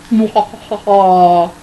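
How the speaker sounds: drawn-out villain laugh; slow evil cackle. Acted. Greedy mother's villain laugh.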